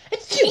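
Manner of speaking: loud, high, hammed-up achoo; theatrical sneeze for the kids; big comic sneeze. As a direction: blast out a cartoon sneeze. Character sneezes again.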